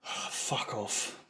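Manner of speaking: very soft, low, voiceless exhale; dejected whisper. Real, not acted. Fan giving up on his team after a miss, whispered sigh. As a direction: sigh it out under the breath.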